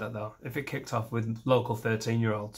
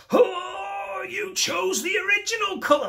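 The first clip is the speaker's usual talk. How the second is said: much higher, loud enraged yell; hammed-up screaming fury; furious shout; 5 dB louder, slower than usual. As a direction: unleash fake rage at the wife's paint choice. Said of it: "I'd be like".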